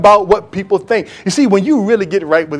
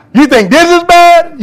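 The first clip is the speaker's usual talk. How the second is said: defiant taunt; 11 semitones higher, 5 dB louder than usual. Retold or imitated. David taunting Michal.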